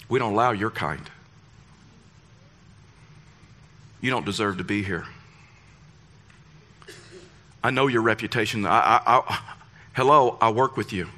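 low, subdued condescension; judgmental. Acted. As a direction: pronounce judgement in a low voice, with long pauses. Churchgoers judging other people.

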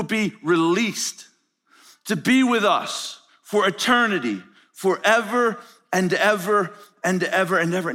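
slow, stirring sermon cadence; slow preaching. Acted. Preacher promising chains will break, slow and stirring. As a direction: lay it out slowly for the church.

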